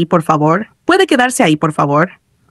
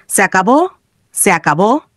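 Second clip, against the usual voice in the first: ordinary delivery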